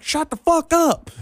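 high mock country-accent snap; country girl. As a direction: imitate an annoyed country girl hollering. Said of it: "And she's like".

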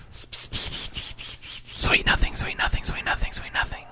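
breathy whisper of sweet nothings. Pretend. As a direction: whisper it softly into their ear. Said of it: "I just whispered sweet nothings into your ear".

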